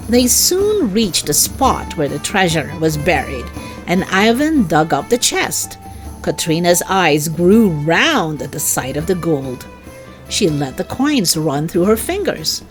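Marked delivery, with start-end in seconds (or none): none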